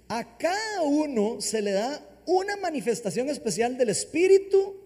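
high, loud, emphatic Bible reading; scripture reading. Real, not acted. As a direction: proclaim the passage, leaning hard on key words. Reads scripture aloud. (lectura bíblica enfática, en voz alta)

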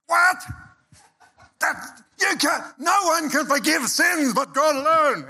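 high, shouted outrage of the Pharisees; outraged Pharisees. Acted. Preacher voicing the Pharisees freaking out, high outraged shout. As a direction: shriek it like scandalised religious leaders. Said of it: "They're freaking out".

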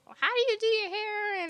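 high, mock-curious fan asking. Imitating fans asking her about her hair, in a high mock voice. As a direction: squeak it like an eager fan.

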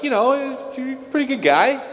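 very high, sing-song flattery; chirpy mock reassurance of a pal; cartoonish high-pitched praise; mock apostles reassuring. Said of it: "they're like".

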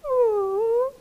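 high whimpering ooh; high frightened whimper; dog moan. Acted. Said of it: "Biff moaned".

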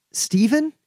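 high, startled gasp of a name; surprised recognition. Acted. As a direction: recognize an old friend with a jolt. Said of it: "she looks up and she goes".

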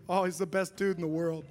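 ordinary delivery

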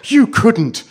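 put-on high, loud childish protest; cried out. A narrator's reading. Children protest their mother's threat, crying out loudly. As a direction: cry out in disbelief. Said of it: "they cried".

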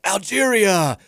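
hammed-up pageant contestant introduction, pitched high; mock pageant self-introduction, high and grand; bombastic announcing. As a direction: belt out the country name, vowels stretched. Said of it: "they're supposed to be very bombastic".

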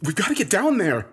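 high-pitched urgent plea. A narrator's reading. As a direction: urge them to move now. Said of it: "I urged them".

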